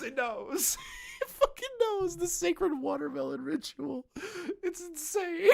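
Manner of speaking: high, squealed words through laughter; cracking up while trying to talk; helpless laughter. Real, not acted. Cracks up at the script.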